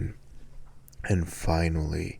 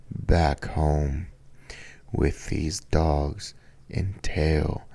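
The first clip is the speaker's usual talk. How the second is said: ordinary talk, nothing marked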